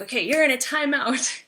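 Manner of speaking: hammed-up scolding parent; mock stern timeout. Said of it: "Instead of being like".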